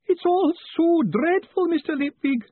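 slow, very high wail of despair; milk the despair, high and drawn out. Acted. Clerk draining the cup of despair, high slow wail. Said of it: "determined to drain the bitter cup of despair".

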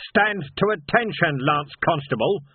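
loud, slow drill-sergeant bark; parade-ground shout. A narrator's reading. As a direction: snap the boy back to order. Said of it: "shouted Vimes".

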